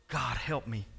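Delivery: normal talking voice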